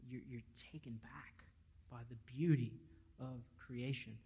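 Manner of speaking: hushed and breathless, taken back; awed whisper. Real, not acted. Preacher to the congregation, hushed in awe. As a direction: let the wonder drop to a whisper.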